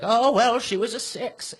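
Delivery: a hint of a gossipy grandma. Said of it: "That was my grandma voice".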